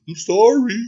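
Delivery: high, apologetic guard voice; reluctant little apology; sheepish guard. Acted. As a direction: apologize helplessly while following orders. Guard apologizes while obeying.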